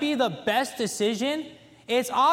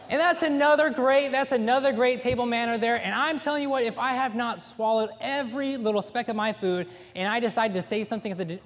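usual speaking voice

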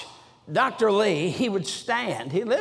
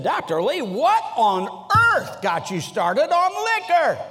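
astonished question; 7 semitones higher than usual. Retold or imitated. Preacher friend asking Doctor Lee afterwards, astonished. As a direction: stretch the words in bafflement.

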